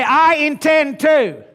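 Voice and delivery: slow shouted pledge for the room to echo; call to repeat. Shown as a demonstration. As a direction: lead the crowd in a shouted pledge.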